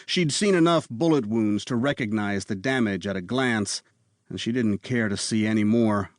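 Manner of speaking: ordinary delivery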